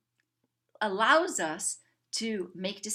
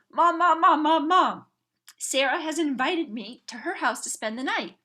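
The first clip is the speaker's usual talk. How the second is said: put-on high, loud, insistent kid calling Mom; a young daughter nagging for a sleepover, excited and pleading; excited little girl; 6 semitones higher, 6 dB louder than usual. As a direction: pester your mom like an eager child. Little daughter begging her mom.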